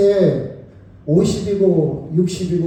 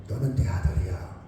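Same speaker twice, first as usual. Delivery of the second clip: whispered father's words; 6 semitones lower, 6 dB quieter than usual. Acted. Soft repeat of parent's words.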